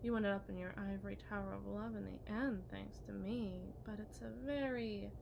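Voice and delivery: ordinary talk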